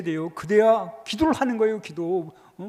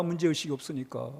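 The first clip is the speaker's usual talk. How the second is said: soft echo; 8 semitones lower, 9 dB quieter than usual. Shown as a demonstration.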